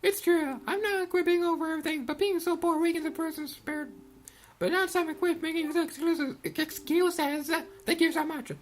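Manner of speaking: put-on high girlish voice, apologetic then thankful; high-voiced earnest gratitude; repentant girl thief. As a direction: confess and give thanks as a young girl.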